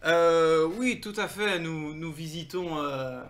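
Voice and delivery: halting, careful voice of a foreign visitor; hesitant stranger with drawn-out words; foreign traveller. Acted. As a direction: answer politely, slowly, feeling for each word.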